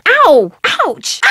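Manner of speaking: a loud sharp cry of pain; high loud ow of pain; pained yelp. Acted. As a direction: yelp as if suddenly hurt. Hurt by something in game.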